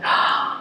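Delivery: a soft sharp intake of breath, imitating her surprise; retold gasp. Client's sudden realisation in a reading, a mild imitated gasp. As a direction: gasp lightly, as if struck by a new idea. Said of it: "and suddenly she just went".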